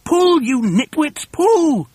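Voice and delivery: high, drawn-out shouted order; slow high yell, climbing; urgent command. A narrator's reading. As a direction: heave them along with your voice. Boss urging helpers to pull.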